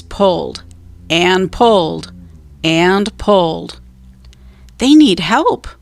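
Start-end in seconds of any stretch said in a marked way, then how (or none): 4.8-5.7 put-on boy pleading. A narrator's reading. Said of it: "said Jack".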